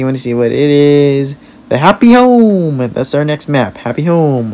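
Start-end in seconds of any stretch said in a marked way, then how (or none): 1.7-2.8 high, drawn-out map reveal; excited announcement. Real, not acted. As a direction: announce it big, stretching the words. Announces the next game map.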